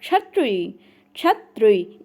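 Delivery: छत्री said twice, joined chha-tri in two syllables; correct pronunciation. Shown as a demonstration. Teacher drills the correct form for the class, छत्री twice. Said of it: "कैसे कंठ और मूर्धन्य मिलाकर बोलना".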